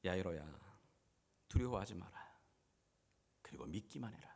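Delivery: calm a frightened father, low and unhurried; gentle voice of Jesus. Acted. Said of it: "주님 뭐라고 말하죠?". (낮고 잔잔하게 안심시키는 말투)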